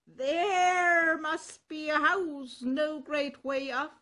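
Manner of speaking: a cock complaining about his mistress; indignant rooster. Acted. Cock calls to companions.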